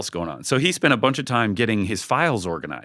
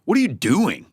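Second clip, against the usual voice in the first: higher, louder accusing "What are you doing?"; accusing check-up; 8 semitones higher, 4 dB louder than usual. Shown as a demonstration. Leader shows the wrong way to ask a team member, a higher, louder accusing question. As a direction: snap the question like a suspicious boss. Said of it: "This isn't".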